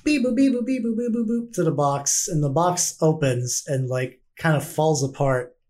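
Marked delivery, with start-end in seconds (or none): 0.0-1.5 high, singsong electronic beeps; imitated keypad beeps. Game master imitating the box being unlocked, chirpy beeps.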